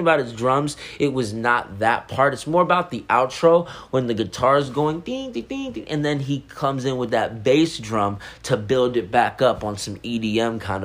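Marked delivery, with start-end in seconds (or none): 5.0-5.9 sung guitar ding. Retold or imitated. Imitating guitar.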